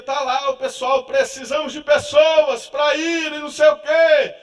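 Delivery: slow, loud preacher's appeal; preaching call. Retold or imitated. Pastor calling the congregation to the mission, loud slow appeal. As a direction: urge the church slowly and loudly. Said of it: "E dizia".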